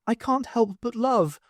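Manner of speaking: nervous small dwarf speaking up; Cute the littlest dwarf. A narrator's reading. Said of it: "He cleared his throat".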